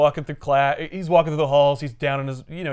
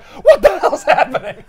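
baffled principal; 11 semitones higher, 8 dB louder than usual. Retold or imitated.

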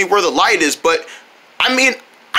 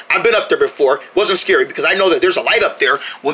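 ordinary talk

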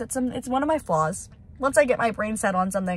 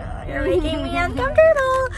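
mock uncomfortable; 11 semitones higher, 7 dB louder than usual. Retelling awkward realtor visit.

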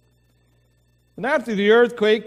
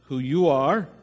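opening prayer; 4 semitones lower than usual. Acted. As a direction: pray gently over the congregation. Pastor leads the opening prayer.

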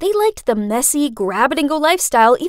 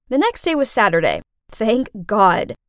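usual speaking voice

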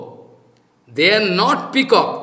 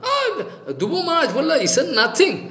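high, scoffing put-on voice with a laugh; mock the dismissive guru like a clown; sneering joker impression, pitched up; 8 semitones higher, faster than usual. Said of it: "if I can become a joker and speak like this".

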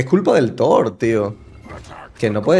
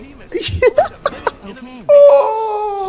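high laughing fit; 3 dB louder than usual. Real, not acted. Risa incrédula.

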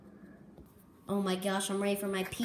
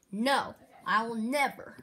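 usual speaking voice

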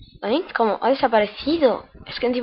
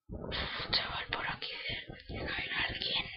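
unvoiced sneaky whisper; 16 dB quieter than usual. Real, not acted. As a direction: whisper as if hiding. Whispering while sneaking in game.

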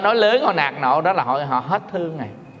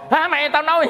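mock angry snap; 9 semitones higher than usual. Quarrelling couple voiced.

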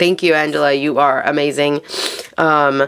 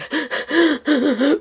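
a sharp high gasp of dismay; high tearful gasp, imitated; retold gasp; 9 semitones higher than usual. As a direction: gasp like you've just been let down. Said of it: "I'm just crying".

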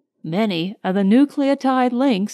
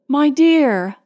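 higher, louder gasped endearment; 4 semitones higher, 4 dB louder than usual. A narrator's reading. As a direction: lightly gasp it out to a loved one. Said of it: "she gasped".

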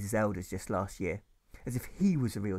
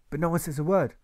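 usual speaking voice

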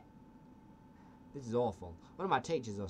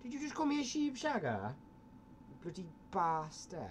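put-on Welsh accent; 4 semitones higher than usual.

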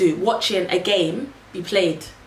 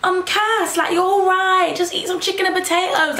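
high, sing-song mocking of the friend; 10 semitones higher than usual. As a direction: parody her breezy advice, pitched up. Friend's advice voiced, mocking.

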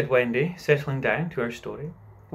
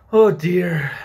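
loud, drawn-out groan of dismay; put-upon Wendy, raised voice; exasperated sigh; 5 semitones higher, 10 dB louder than usual. A narrator's reading. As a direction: sigh out loudly in weary protest. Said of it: "sighed Wendy".

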